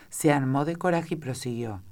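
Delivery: ordinary delivery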